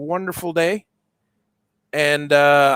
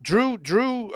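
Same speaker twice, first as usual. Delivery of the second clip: ordinary talk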